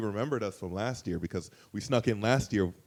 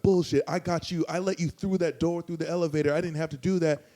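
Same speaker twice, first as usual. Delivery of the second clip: higher, imitating an indignant man; hammed-up higher complaint; mock angry accusation; 7 semitones higher than usual. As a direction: play the man calling bullshit. Guy accuses him angrily.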